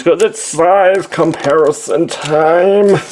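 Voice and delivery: high, loud announcer call. Real, not acted. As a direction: boom it out like a show host. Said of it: "I don't know what that was".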